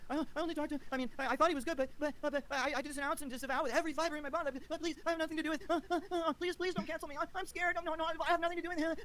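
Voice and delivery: hammed-up pleading impression of a scared streamer; mocking whiny impersonation. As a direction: whimper like a cowering coward. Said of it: "what was that impersonation, [name]?".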